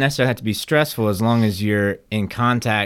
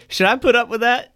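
mock indignant owner; 10 semitones higher than usual. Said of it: "just turn and say".

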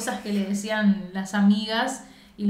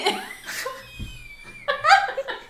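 high breathless laughing fit; long high laugh. Real, not acted. Friend laughing at how the actress cries, in a long high laughing fit.

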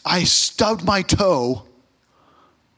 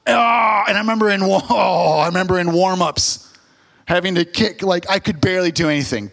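ordinary talk

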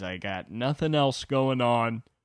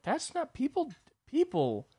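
much higher sputtering; flustered protest; 13 semitones higher, 3 dB quieter, slower than usual. Retold or imitated.

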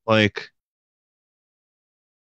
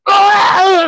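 loud shrill cartoon yell; high pained scream; 5 dB louder than usual. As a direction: shriek as it hits you. Spirit bomb hits him.

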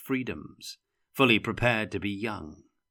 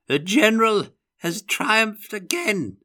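loud, high-pitched cry of victory; triumphant shout; 13 semitones higher, 8 dB louder than usual. A narrator's reading. Old Elector proclaims victory with his toy lead hero, triumphant shouting. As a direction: crow it out like a doddering commander. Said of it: "shouted the Elector".

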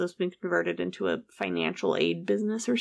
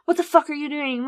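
higher, acted exasperation; pitched-up mock outrage; retold pissed-off outburst; 7 semitones higher, 4 dB louder than usual. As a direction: imitate the sister's angry demand.

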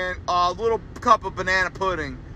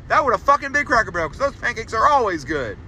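usual speaking voice

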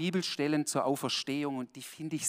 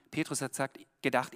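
normal talking voice

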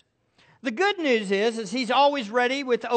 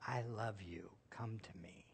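soft, whispered invitation; hushed, tender come to me; 14 semitones lower, 24 dB quieter than usual. Retold or imitated. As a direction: breathe it like a loving father calling a child home. Heavenly Father speaking tenderly.

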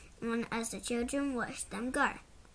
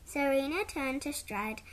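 usual speaking voice

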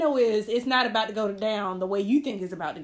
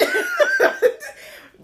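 loud high cackle of laughter; laughing out loud; 7 dB louder than usual. Real, not acted. Friend cracking up at the story.

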